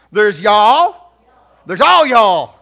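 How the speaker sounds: very loud Southern drawl; Southern accent. Shown as a demonstration. Preacher teaching the plurals of you, booming Southern accent. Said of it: "bust out a little bit of Southern talk".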